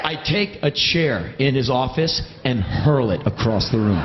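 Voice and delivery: ordinary delivery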